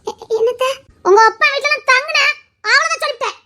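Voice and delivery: high-pitched scolding warning; stern warning. Acted. Mother-in-law warns her sternly.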